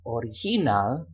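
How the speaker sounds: "original" in Spanish as o-ri-HI-nal; stress on third syllable. Shown as a demonstration. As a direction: lean on the HI sound in "original". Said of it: "which syllable to stress".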